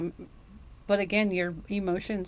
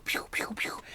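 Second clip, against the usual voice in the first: whispered pew pew pew, like tiny shots; whispered pew sounds; 8 dB quieter than usual. Pretend. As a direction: softly mimic little laser shots. Mimicking shots at the listener.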